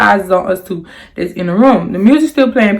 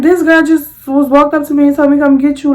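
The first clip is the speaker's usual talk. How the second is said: indignant disbelief; 7 semitones higher, 4 dB louder than usual. Retold or imitated. Indignant retelling.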